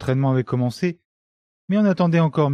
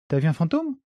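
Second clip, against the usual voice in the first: higher, teasing question; mocking; 6 semitones higher than usual. A narrator's reading. Café owner teases pale Célestin, mocking. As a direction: poke fun with a lifted voice. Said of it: "s'était moqué le cafetier".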